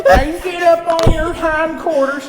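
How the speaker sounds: put-on herd the prisoner into the cell like an old west lawman; mock gruff sheriff. Sheriff drives the cowboy into the cell, mock gruff and loud. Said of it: "like our performance".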